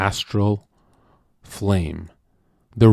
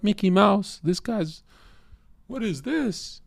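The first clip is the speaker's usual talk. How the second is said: mock woman; 10 semitones higher, 4 dB quieter, slower than usual. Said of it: "The last guy was like".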